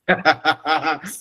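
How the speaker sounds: short rising burst of laughter; real laugh. Real, not acted. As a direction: laugh it off, amused. Laughs at audience point.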